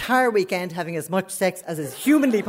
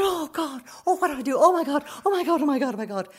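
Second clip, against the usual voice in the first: high-pitched flustered repetition; retold panic; 8 semitones higher, faster than usual. Her mother is outside the cubicle, high panic. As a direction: start very high and let it tumble down.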